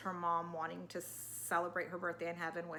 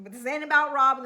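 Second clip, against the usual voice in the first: usual speaking voice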